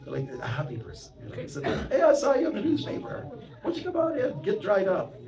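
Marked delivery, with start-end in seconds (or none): 1.9-5.2 high, loud, cheerful invitation; jolly dealer. Retold or imitated. Dealer inviting the monk in from the rain, high and loud. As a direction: play a jolly car salesman calling him in.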